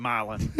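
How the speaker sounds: Milan said as MY-lun; "Milan" rhyming loosely with "island"; own mispronunciation. Real, not acted. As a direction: stress the first syllable of Milan, long i. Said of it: "I've, I've always called it".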